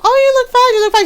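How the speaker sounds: high-pitched placating aunts; imitated reassurance. Other aunts reassure her about the dress, mock high-pitched reassurance. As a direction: pitch it up, quick polite reassurance.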